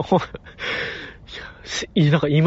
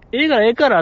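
put-on brushing-off old man; 7 semitones higher, faster than usual. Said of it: "って".

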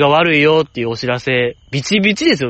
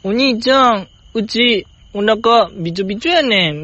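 a higher, lightly voiced little Kansai girl; playful higher little-girl voice in Kansai dialect; imitating Setsuko; 8 semitones higher than usual. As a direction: mimic a weak little sister's plea, pitched up. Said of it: "節子が言ってたっしょ".

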